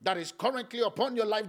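loud, high, pleading voice of God; God speaking. Acted. Preacher voicing God searching his son on the cross, loud and high. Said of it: "God looked at Jesus and he's like".

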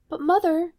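high, loud plea; pleading daughter. Acted. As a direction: call out to Mother, worried. Rapunzel pleads with Mother.

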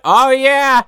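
high, bouncy Oh yeah; a chirpy Santa opener; jolly Santa. Retold or imitated.

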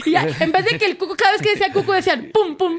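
laughing while telling the story. Real, not acted. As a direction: giggle the words out.

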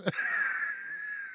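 a shrill burst of real laughter, way up high; helpless high-pitched giggle; squealing high laugh. Real, not acted. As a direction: crack up at your own joke, squeaking. Cracking up at own joke.

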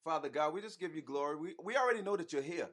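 quiet, earnest prayer voice; praying. Acted. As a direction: pray it softly, from the heart. Husband leads an opening prayer.

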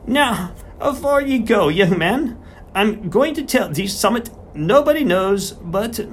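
put-on high, slowish voice of an old Yorkshire countryman; a garrulous old farmer settling in to talk. Old farmer turns serious.

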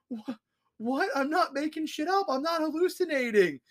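high, indignant protest; protesting kid. Pretend. Kid protesting to the therapist, high and indignant. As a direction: squeak out the denial in disbelief.

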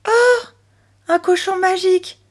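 put-on high-pitched little girl's amazement; amazed exclamation. A narrator's reading. Chloé discovering her pig can talk, amazed exclamation. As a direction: gasp it out high, full of wonder. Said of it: "s'exclama Chloé".

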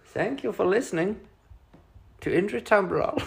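put-on German accent. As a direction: sign off in a bad German accent. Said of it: "we'll do a German accent".

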